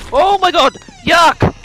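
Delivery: loud grossed-out shout; disgusted yell. Real, not acted. Streamer reacts to gross monster.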